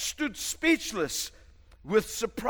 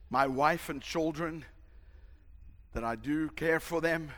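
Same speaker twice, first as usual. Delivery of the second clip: mock farewell; 4 semitones lower, 6 dB quieter than usual. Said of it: "said to his friends".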